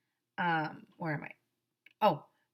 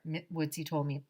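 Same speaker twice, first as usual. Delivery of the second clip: ordinary delivery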